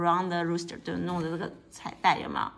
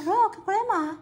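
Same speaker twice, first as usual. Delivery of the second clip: put-on children shouting; 12 semitones higher, 5 dB louder than usual. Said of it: "They shouted".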